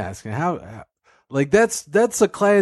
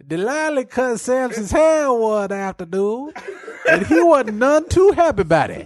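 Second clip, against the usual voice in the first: higher laughing storyteller voice; narrator character voice; 10 semitones higher than usual. Pretend. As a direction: pitch up and laugh through the tale. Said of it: "Different guy now".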